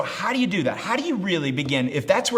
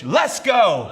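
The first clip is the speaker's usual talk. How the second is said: loud, raised cheer; rallying shout; 6 semitones higher, 6 dB louder than usual. Shown as a demonstration. Preacher has the congregation shout the sermon title, rallying shout. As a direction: shout it to fire up the crowd. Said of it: "just shout the title of my message".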